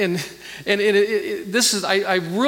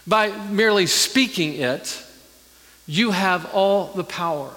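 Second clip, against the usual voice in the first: fervent preaching; 3 semitones higher than usual. Acted. Pastor driving home God's authority with fervent preaching. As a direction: preach it with rising fervor.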